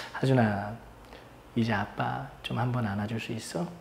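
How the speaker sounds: soft, low plea; tender request. Retold or imitated. Dad asking crying son for a hug, soft and tender. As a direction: ask very gently, almost hushed.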